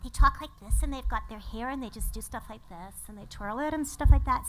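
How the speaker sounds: lightly voiced girly talk; girl voice. Shown as a demonstration. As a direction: talk like a girl twirling her hair. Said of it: "Whereas girls".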